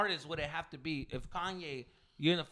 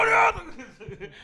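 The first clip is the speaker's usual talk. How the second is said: much higher, hammed-up adoring cry; gushing fan; 10 dB louder, much slower than usual. Mocking a friend gushing at the singer, high and hammed-up. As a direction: gush it like a starstruck fan. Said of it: "he's gonna be like".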